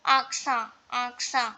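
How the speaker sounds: "Aksa" ending on an open vowel, no T; silent T. Shown as a demonstration. Teacher repeating the example, "Aksa" with silent T. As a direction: say "Aksa" with the final T dropped. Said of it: "Here also T is not pronounced".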